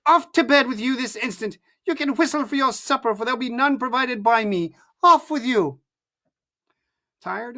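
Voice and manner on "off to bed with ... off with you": loud scolding order; mother shouts her son off to bed; angry shouting. A narrator's reading. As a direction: send him off to bed with a shout.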